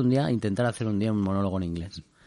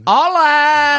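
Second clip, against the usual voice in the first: loud high mock "Hola"; shouted greeting; 10 dB louder than usual. Co-host joking with a loud high greeting. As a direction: yell hello like a caricature.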